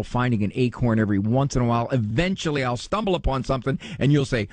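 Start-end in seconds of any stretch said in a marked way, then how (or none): none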